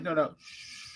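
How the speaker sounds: low, quiet shushing; soft hushing. Retold or imitated. Bouncer shushes the drunk man, soft and low. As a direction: hush him gently.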